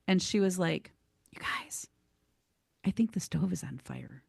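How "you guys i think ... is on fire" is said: whispered, barely audible warning; a shy friend whispers so no one hears; timid whisper. Retold or imitated.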